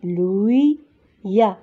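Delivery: لويا drawn out slowly, gliding through y into a; slow teacher's demo of لويا, joined by a y sound; liaison with a y-glide. Shown as a demonstration. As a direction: stretch لويا, linking the i to the a with a y. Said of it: "لا ليزون غتولي عندنا لويا".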